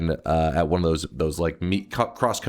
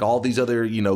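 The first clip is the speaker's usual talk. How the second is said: normal talking voice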